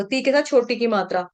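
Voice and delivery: normal talking voice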